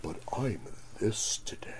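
very soft, low, near-whispered mimicry; impression of the customer. Imitating the difficult customer, barely audible and low. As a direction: shrink to a hushed mock customer voice. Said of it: "And he does this".

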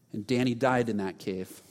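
normal talking voice

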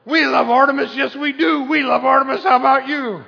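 loud, high pep-rally chant; cheering fan crowd. Retold or imitated. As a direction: whip up the stands like a fan. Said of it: "It's like".

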